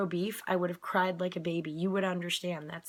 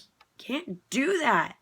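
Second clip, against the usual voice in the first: firm insisting; 5 semitones higher, 7 dB louder, slower than usual. Retold or imitated. Advocate retold insisting.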